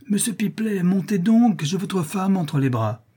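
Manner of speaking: high, insistent calling voice; urgent call. A narrator's reading. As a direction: call down from upstairs for help. Voice calls urgently from upstairs.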